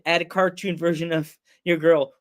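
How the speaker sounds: ordinary delivery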